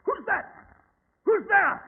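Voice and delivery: high, loud frightened challenge; shouted challenge. Acted. Watson challenges a shape in the dark wood, shouting. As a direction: bark a sentry's halt into the dark.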